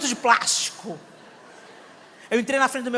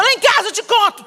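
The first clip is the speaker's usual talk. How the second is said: much higher, louder exasperated yell; gritando com o marido; 9 semitones higher, 6 dB louder than usual. Retold or imitated. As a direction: shout him down. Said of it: "Tô gritando com ele". (gritando irritada com o marido)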